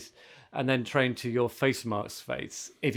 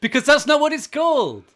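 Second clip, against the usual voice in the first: much higher, much louder exasperated shout; exasperated outburst; 14 semitones higher, 10 dB louder than usual. Real, not acted. Host shouts down co-host over the iPhone's name, exasperated. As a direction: shout it down, fed up. Heated naming argument between co-hosts.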